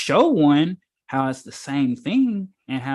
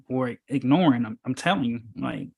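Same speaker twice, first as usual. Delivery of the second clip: usual speaking voice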